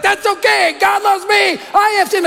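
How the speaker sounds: put-on high, insistent little-boy voice; imitated chattering child. Five-year-old butting in on dad's preaching, high and insistent. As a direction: pipe up like a kid who won't shut up. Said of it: "my son Jeremy won't shut up".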